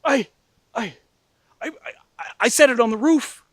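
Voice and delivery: high, loud, stammering panic; imitating a brother's dawning shock. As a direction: stammer out a horrified confession, voice shooting up. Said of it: "The look of shock".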